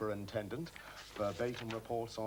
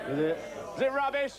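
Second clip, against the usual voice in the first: loud question climbing high; goading the crowd; 13 semitones higher, 8 dB louder, faster than usual. Acted. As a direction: holler it at the audience for an answer. Working up the audience.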